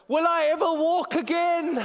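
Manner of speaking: high, loud, slow melodrama, laughing through it; dramatic wail. Retold or imitated. Carried to his room, he calls over his shoulder, hammed-up despair. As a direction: ham up the tragic invalid. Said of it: "You were very dramatic".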